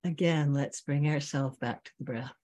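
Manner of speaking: slow meditation voice with long pauses; guided meditation. Real, not acted. Teacher bringing students back to the breath, guided meditation. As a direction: guide them gently inward, leaving long silences.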